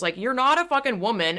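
ordinary delivery

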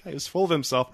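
ordinary talk, nothing marked

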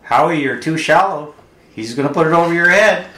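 loud, high heckle from the stands; mock yell across the ballfield; imitated yelling. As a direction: yell it like a rowdy spectator, playfully. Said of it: "then they start yelling".

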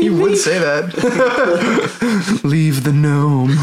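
slow, lightly voiced imitation; Jeremy impression. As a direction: drag it out like a friend. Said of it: "That was my Jeremy impression".

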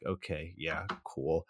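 soft, low, unimpressed reply; underwhelmed acceptance. Acted. Viewer reacting to a random Sun Tzu quote, underwhelmed. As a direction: let it drop quietly, humoring them.

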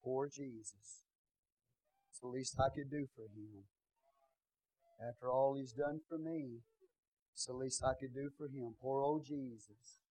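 soft, slow, pitying sigh-voice; hammed-up pity for poor Jesus; mock pitiful. Altar-goers pitying Jesus, soft mock-pitiful sigh. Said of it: "They went to the front because".

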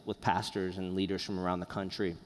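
usual speaking voice

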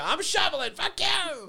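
high-pitched angry holler; acted-out fed-up shouting; yelling back. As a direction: scream back across the noise. Acts out yelling back at dad.

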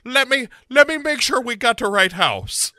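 overacted sleuth taking charge; Sarah. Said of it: "Sarah's like".

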